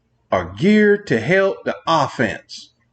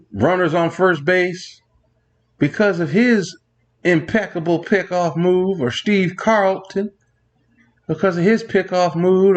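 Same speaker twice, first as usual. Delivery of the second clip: ordinary talk, nothing marked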